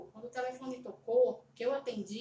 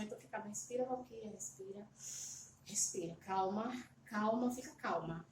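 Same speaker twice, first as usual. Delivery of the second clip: soft self-calming; 3 semitones lower, 6 dB quieter than usual. Retold or imitated. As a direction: ease yourself down, gently. Calming herself during fainting spell.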